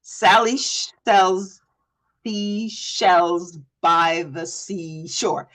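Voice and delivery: slow, halting stumble over the words; halting first try at a tongue twister; fumbled tongue twister. Shown as a demonstration. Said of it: "The first time you do a tongue twister".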